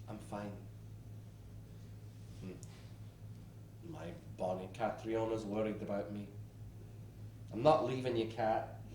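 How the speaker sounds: soft reassuring. Real, not acted. Soft reassurance after wheeze.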